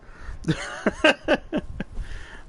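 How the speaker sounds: airy high-pitched giggle. Real, not acted. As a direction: giggle at your own joke. Laughing after embarrassing demo.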